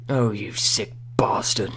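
slow, breathy whisper to the mic; slow mock whisper. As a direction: whisper it slowly like a creepy confession. Whispering to his microphone.

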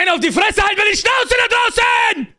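angry yell. Acted. Prisoner yelling at the noisy people outside. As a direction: yell through the wall to shut him up.

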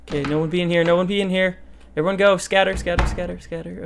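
loud frantic commands to scatter; urgent shooing. Real, not acted. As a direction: shoo everyone away urgently. Shooing ghosts away.

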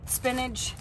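normal talking voice

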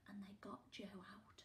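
suspenseful whisper. Acted. Reader telling the night kidnapping, whispered. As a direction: whisper the theft like a secret.

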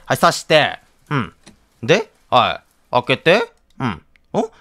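ordinary talk

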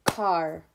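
Car as one separate, drawn-out beat; clapped syllable. Shown as a demonstration. Teacher leading preschoolers in clapping, Car as one clapped syllable. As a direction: say Car on a single clap, set apart. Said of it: "Clap it with me again".

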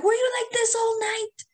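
high, alarmed "were you like this all night"; shocked question. Retold or imitated. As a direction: ask it like a horrified mom. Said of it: "they're like".

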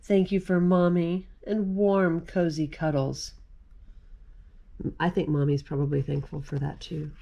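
gentle read-aloud of a rhyming picture book; reading to children. Acted. Mom reading a Thanksgiving book to kids, gentle read-aloud.

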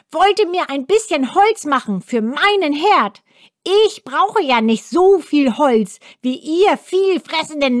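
hohe, keifende Zwergenstimme, schnell und gereizt; zeternder Zwerg. A narrator's reading. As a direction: schimpf wie ein ungeduldiger alter Zwerg. Zwerg schimpft weiter. (hohe, zeternde Zwergenstimme)

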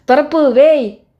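long, loud, high call across the dark; slow hail to someone unseen; shouting a name, drawn out and slow. Acted. Calling out for missing man.

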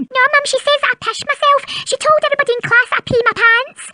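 normal talking voice

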